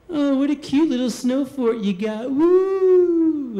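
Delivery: put-on high, sing-song sneer at a little kid's snow fort; mocking taunt. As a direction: tease him like a bully, then whoop loudly. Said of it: "he's like".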